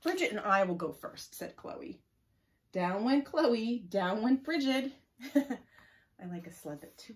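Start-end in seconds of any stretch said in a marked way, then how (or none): 0.0-4.9 loud, drawn-out storytelling swoops; story read-aloud. Acted. Reading children the first sled run, loud and drawn-out. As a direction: stretch it out for young listeners.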